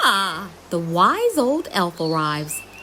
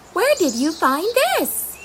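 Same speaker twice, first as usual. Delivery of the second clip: curious; 11 semitones higher than usual. Acted. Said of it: "looking at the object curiously".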